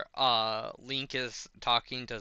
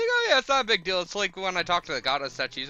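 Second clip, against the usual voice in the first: high, fast and breezy; nonchalant Link; 8 semitones higher, 8 dB louder, faster than usual. Retold or imitated. Link brushing it off to Purah, high and breezy. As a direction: play it down lightly.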